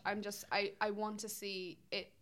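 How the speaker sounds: ordinary talk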